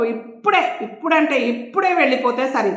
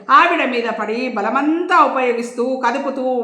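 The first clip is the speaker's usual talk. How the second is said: ordinary delivery, nothing marked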